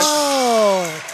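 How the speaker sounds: long, loud, high "oh"; excited cheer. Real, not acted. Announcer reacting to a three-pointer, loud excited cry. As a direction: cry out as the shot goes in.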